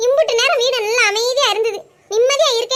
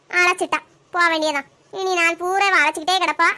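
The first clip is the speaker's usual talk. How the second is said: ordinary talk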